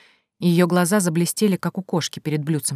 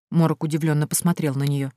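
ordinary delivery

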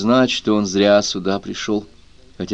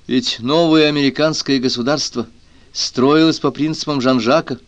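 plain reading voice

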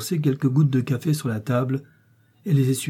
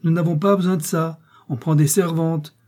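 irritated, higher-pitched retort; scold, with the voice raised; 6 semitones higher, 3 dB louder than usual. A narrator's reading. Mother brushing off her son's argument, scolding. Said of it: "gronda la mère".

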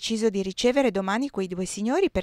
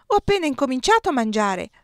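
higher, louder protest; 5 semitones higher, 5 dB louder than usual. A narrator's reading. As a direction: complain like a hungry child.

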